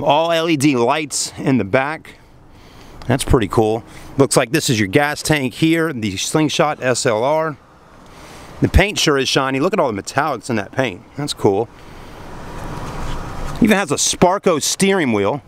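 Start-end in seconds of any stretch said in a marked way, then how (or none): none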